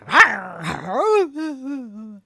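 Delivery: loud playful rawr growl; hammed-up grumpy roar; mock growl. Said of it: "this day I was like".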